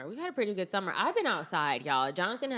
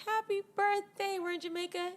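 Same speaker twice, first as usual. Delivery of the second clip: retold birthday greeting, pitched way up; chirpy greeting. Granddaughter greets grandfather, very high and bright. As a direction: sing it out sweetly to grandfather. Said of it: "I'm like".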